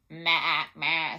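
loud goat maa at speaking pitch; goat bleat. A narrator's reading. Teacher reading the goat's part to children, loud bleating. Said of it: "said the goat".

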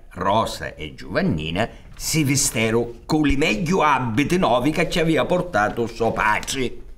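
ordinary talk

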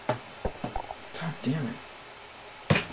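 under-the-breath whispered swear; whispered curse. Real, not acted. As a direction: hiss it to yourself. Muttered curse during play.

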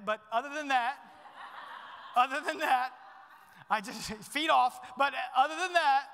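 real laughter through the words; laughing about the daughter's rule on feet. Real, not acted. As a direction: chuckle your way through it. Laughing at his own joke.

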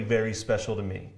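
ordinary delivery

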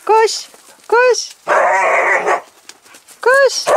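high-pitched eager urging. Real, not acted. Owner sending the animals running, high eager urging. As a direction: cheer them on in a high voice.

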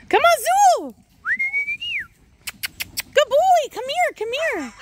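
very high, sing-song praise; dog-coaxing voice. Real, not acted. Owner coaxing her puppy over, high and sweet. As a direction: coax the puppy in a squeaky high voice.

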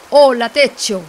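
loud mouse greeting; ratón muy alto. Acted. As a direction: call out hello in a loud character voice. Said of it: "dijo ratón muy alto". (saludo fuerte de ratón)

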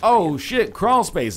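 loud, high burst of excitement; excited. Retold or imitated. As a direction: shout it like a thrilled find. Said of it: "They'll be like".

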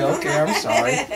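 high, loud, hurried-off apology; flustered apology. Acted. Waitress running away from the angry guest, flustered. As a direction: apologize as you flee.

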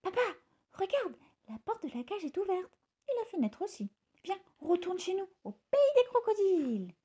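high, eager little bird voice; lightly hushed, high bird voice; little bird César. A narrator's reading. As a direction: urge papa along in a high voice.

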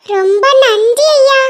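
put-on high child's voice, slow drawn-out thanks; a boy thanking sweetly; grateful little boy. Ringu thanks the stranger, high and slow.

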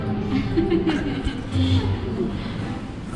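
long, high giggle; laughter. Real, not acted. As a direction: let a high chuckle run on.

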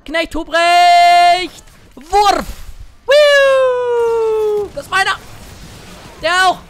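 high, loud battle cries, the first drawn out slowly; hype shouts. Real, not acted. Streamer chasing enemy champions and claiming kills, loud excited shouts. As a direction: bark each kill call at the top of your voice. Hunting enemies after the fight.

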